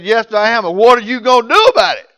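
defiant comeback. Retold or imitated. Angry customer daring the store manager, loud defiance. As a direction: challenge him, quickening at the end.

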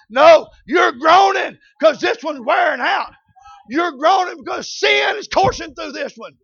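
loud preacher's cadence; preacher urging the church about groaning; full-force preaching. Acted.

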